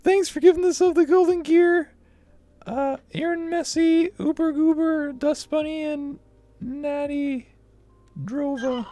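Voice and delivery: put-on very high cutesy anime cat girl; squeaky kitten voice thanking fans. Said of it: "I have to do the cat girl voice".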